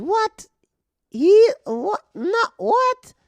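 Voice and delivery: very high, loud squawked disbelief; mock the heckler's shocked sputtering in a piercing high voice; imitated indignant yelping; outraged heckler. Acting out heckler's outraged reply.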